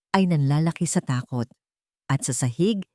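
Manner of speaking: plain reading voice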